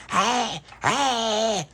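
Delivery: high crying hey; wailing vampire calls. Retold or imitated. Dolores getting Stella, high wailing calls. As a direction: wail it out. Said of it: "like that".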